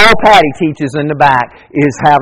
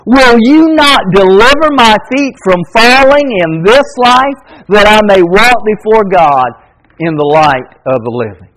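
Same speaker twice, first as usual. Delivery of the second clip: raised, ringing reading of a psalm; scripture reading; 6 semitones higher, 4 dB louder than usual. Acted. Preacher reading a psalm to his congregation, ringing proclamation. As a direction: proclaim the verse to the church.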